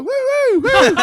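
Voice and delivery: high, loud woos; celebratory whoop. Retold or imitated. Hunters overhearing they get the deer, high loud whooping. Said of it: "they overhear this and go".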